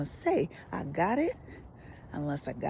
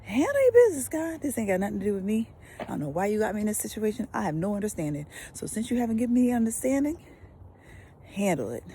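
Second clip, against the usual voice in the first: sassy, fast, bossy demand to God; with attitude; 3 semitones higher, faster than usual. Shown as a demonstration. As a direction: tell God to handle it, no patience. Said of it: "you can say it with attitude".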